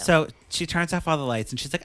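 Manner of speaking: ordinary delivery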